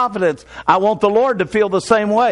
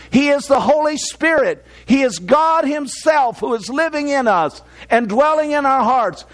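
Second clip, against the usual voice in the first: higher-pitched, drawn-out sermon delivery; preaching; 5 semitones higher than usual. Real, not acted. Preacher urging the church not to be distraught, preaching. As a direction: preach it in firm, measured phrases.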